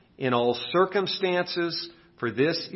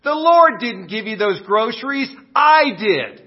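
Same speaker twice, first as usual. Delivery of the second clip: higher, louder triumphant taunt; gloating atheist; 8 semitones higher, 8 dB louder than usual. Acted. Atheist springing from the bush at the widow, gloating. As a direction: jump out and gloat. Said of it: "jumps out from behind a bush and says".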